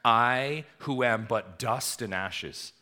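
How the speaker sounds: slow, humble plea; Abraham confessing he is dust. Acted. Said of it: "Listen to Abraham".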